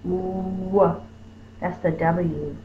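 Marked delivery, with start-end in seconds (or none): 0.0-1.0 W with lips pushed out round; W sound demo. Shown as a demonstration. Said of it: "My lips are out like I'm gonna kiss my mom".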